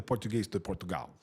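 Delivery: Portugal said with a Portuguese accent. Shown as a demonstration. Host naming the Portugal variety, in a Portuguese accent. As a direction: put on a European Portuguese accent. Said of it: "two types of Portuguese".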